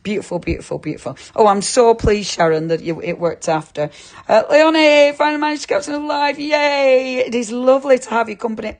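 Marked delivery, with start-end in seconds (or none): 4.3-8.8 high, fast, delighted welcome; bubbly excited hello to a viewer; excited greeting. Real, not acted. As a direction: greet a newcomer with a burst of joy. Greeting a viewer joining the live.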